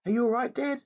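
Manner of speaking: high anxious call; worried call. Acted. Boy calling down into the pit to his father, worried.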